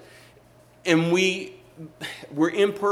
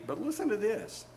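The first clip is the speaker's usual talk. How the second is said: ordinary delivery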